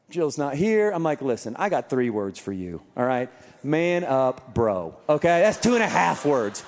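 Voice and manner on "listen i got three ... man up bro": low, unsympathetic tough-love voice; stern dad. Retold or imitated. Dad telling limping son to toughen up, low and stern. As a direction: drop low, no compassion at all.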